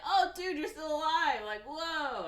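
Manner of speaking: amazed friend. Retold or imitated. Timo voiced finding Mickey alive.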